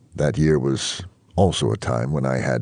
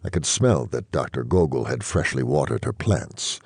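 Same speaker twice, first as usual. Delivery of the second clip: usual speaking voice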